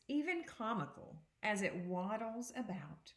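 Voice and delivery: put-on expressive read-aloud to children; picture book read to students; reading aloud to a class. Teacher reads picture book aloud.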